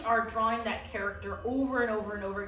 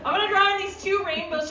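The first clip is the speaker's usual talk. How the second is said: higher and louder, bursting with energy; excited announcement; 8 semitones higher, 8 dB louder than usual. Acted. Teacher hyping up the class about drawing the rainbows, excited. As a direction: announce the rainbows like big news.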